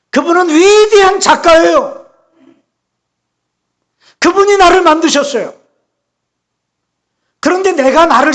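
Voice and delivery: high, loud, fervent proclamation; full-force preaching. Real, not acted. Preacher declaring God the great maker, fervent preaching. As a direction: proclaim it to the congregation, stretching key words.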